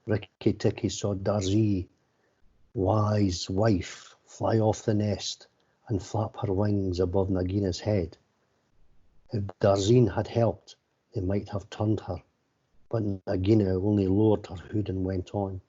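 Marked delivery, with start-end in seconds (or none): none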